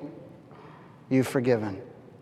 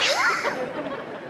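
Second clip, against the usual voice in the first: scheming laugh; 7 dB quieter than usual. Retold or imitated. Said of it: "in my little dad brain, it went".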